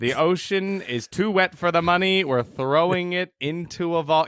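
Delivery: mock reporter voice, dragged out slowly; slow, deliberate old radio bulletin; talking slow. Reporter on money in the ocean, slow mock bulletin. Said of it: "Talk so slow".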